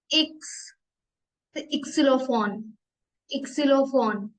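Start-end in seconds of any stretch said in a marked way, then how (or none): 0.1-0.5 इक्स with a short i before ks; German X sound. Shown as a demonstration. Teacher demos the German letter X as इक्स. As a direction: clip it: इक्स. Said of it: "एक्स को हम pronounce करेंगे इक्स".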